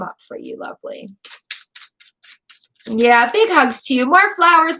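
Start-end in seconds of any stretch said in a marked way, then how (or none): 0.0-1.1 soft whisper. Real, not acted. Tender aside to grieving viewer. 4.1-4.8 more flowers, called out higher and louder; rally the chat, a bright louder call; eager call. Real, not acted. Calling the chat for flowers, eager and louder.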